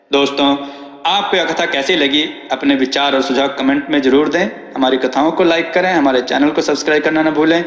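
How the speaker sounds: brisk, fast call to like and subscribe; channel outro. Acted. Narrator urging viewers to like and share, fast promo read. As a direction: rattle off the outro quickly.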